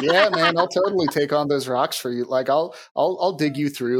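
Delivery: normal talking voice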